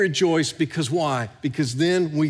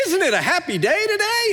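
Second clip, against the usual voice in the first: much higher, chipper mock news anchor; a hammed-up sunny TV announcer; cheery newscaster; 13 semitones higher, 3 dB louder than usual. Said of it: "they'll go".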